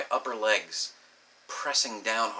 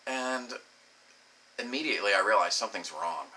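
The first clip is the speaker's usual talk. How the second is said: ordinary talk, nothing marked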